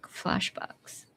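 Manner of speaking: ordinary talk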